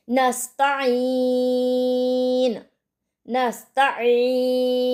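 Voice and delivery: नस्ताइन with a clear ain from the throat, slow. Shown as a demonstration. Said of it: "आइन का मखरज हलक से अदा होता है".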